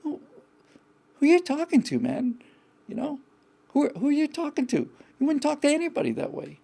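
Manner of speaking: high-pitched, incredulous challenge to himself; retold self-rebuke. Catching his own harsh self-talk, high incredulous rebuke. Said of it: "I remember just saying".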